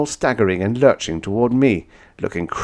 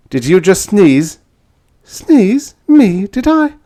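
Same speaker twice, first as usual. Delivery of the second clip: high, louder startled denials; 13 semitones higher, 10 dB louder, slower than usual. Acted. Woman startled by the sneeze, high and loud. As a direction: blurt out in surprise.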